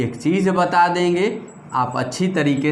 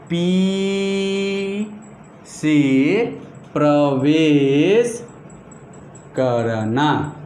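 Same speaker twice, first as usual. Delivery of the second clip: very slow, stretching each word; drawn-out dictation; much slower than usual. Shown as a demonstration. Teacher giving students a rule to remember, drawn-out dictation. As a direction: draw out पी, से, प्रवेश and करना, word by word.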